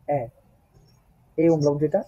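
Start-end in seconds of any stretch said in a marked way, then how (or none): 0.1-0.2 এ said long and open; German ä. Shown as a demonstration. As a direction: stretch the এ. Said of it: "এটাকে বলতে হবে".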